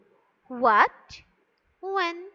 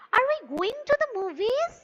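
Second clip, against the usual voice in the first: high question climbing to "movies"; rising intonation; 10 semitones higher, 3 dB louder than usual. Shown as a demonstration.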